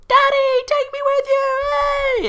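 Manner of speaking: very high-pitched, loud mock little kid; child calling Daddy. Olimar's son begging his dad to take him along, high mock child voice. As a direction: squeal it like a pleading little son. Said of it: "he doesn't sound like that".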